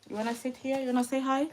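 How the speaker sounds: soft, gentle questions to her little son; coaxing him to say hi; soft mum voice. Real, not acted. Mother talking to child.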